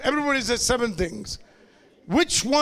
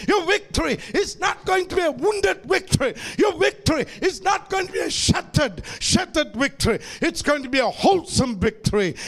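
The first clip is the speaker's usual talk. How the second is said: preaching at full force; 5 semitones higher, faster than usual. Acted. Preacher building to triumph, full-force preaching. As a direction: hammer each victory line.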